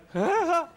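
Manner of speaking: high, loud mock cackle; loud hysterical burst, acted out; shrieking mock audience laughter; imitated hysterical laugh. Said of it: "And the audience is hysterical".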